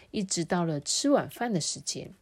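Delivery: plain reading voice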